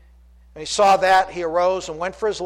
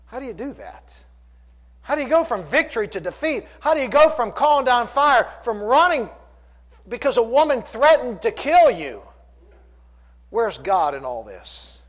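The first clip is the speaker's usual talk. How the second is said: rising, pressing questions; rhetorical preaching; 5 semitones higher than usual. Acted. Preacher asking how Elijah fell, pressing and fervent. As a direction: press the congregation with each question.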